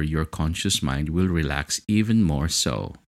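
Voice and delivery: plain reading voice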